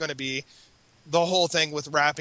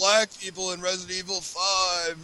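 hammed-up indignant complaint; put-on aggrieved protest; mock outrage; 3 semitones higher, 4 dB louder than usual. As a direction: whine like an outraged critic, half in jest. Acts out outraged online complainers.

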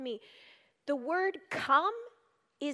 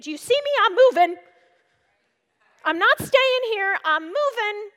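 loud, high, defiant cry of resolve; loud declaration; 5 semitones higher, 10 dB louder, faster than usual. Acted. To God, declaring she won't stay stuck, loud and defiant. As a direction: shout it out like a vow.

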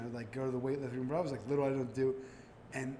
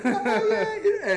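laughing mock brag; 10 semitones higher, 10 dB louder than usual. Retold deep voice joy.

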